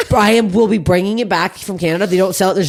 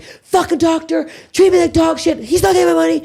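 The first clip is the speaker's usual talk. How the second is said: very high, heated ranting; retold furious rant; 12 semitones higher than usual. Retelling the angry call to Jefferson about the doctor, high-pitched fury. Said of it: "so fucking pissed".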